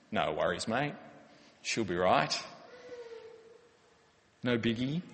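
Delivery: lightly voiced laid-back Aussie brush-off; casual Aussie. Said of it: "it sounds like a good Aussie sentiment".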